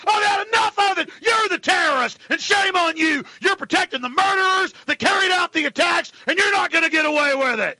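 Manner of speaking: furious shout. Acted. Host yelling at secret police, furious shout. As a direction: rage at the secret police like you're in their face.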